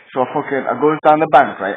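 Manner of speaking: ordinary delivery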